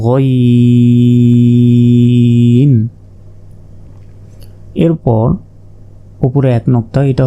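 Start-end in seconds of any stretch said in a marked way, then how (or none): 0.1-2.7 গইন held very long from the end of the throat; drawn-out Arabic letter. Shown as a demonstration. Teacher models গইন for students, very slow. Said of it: "হলকের শেষ ভাগ হতে".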